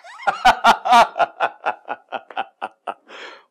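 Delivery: loud, high pleased laugh; laugh, chuffed and embarrassed; delighted giggle at the news; flattered laugh. Acted.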